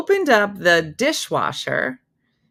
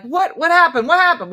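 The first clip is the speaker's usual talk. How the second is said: alarmed; 11 semitones higher, 9 dB louder than usual. Retold or imitated. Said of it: "my mom and I were like".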